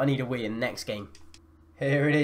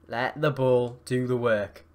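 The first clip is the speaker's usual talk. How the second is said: measured, drawn-out coaching; slow, deliberate; slower than usual. Real, not acted. Gamer calming his players on the ball, slow and deliberate. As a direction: spell it out slowly, word by word. Repeats the advice slowly, deliberately.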